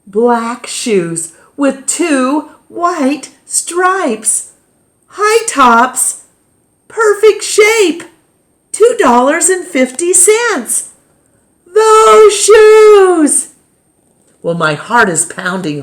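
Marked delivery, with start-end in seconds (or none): 0.1-10.9 rising thrill, higher and louder; building wonder at finding them; excited. A narrator's reading. Spotting the dream shoes. 11.7-13.5 high, loud, half-sung joy; thrilled cry. A narrator's reading. Finally finding the shoes.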